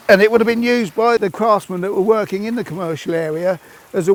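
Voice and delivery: panting between phrases from pushing; breathless, gasping for air mid-sentence; out of breath. Real, not acted. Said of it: "we're both out of breath now".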